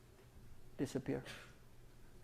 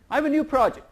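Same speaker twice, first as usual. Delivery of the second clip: eager studio exec asking for money, pitched higher and louder; movie guy pitch; 9 semitones higher, 7 dB louder than usual. Acted. Movie guy pitching a new project to the CFO, higher and louder. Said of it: "I'll be the movie guy".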